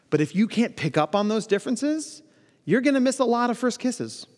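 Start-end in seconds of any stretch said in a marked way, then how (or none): none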